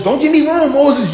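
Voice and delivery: ordinary delivery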